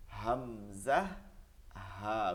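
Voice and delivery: low, grave demonstration of Arabic letters; deep solemn voice. Shown as a demonstration. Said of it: "গম্ভীর আওয়াজে".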